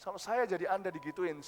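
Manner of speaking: ordinary talk, nothing marked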